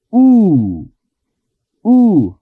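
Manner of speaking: U with lips only slightly apart; U lèvres à peine ouvertes; U sans arrondir. Shown as a demonstration.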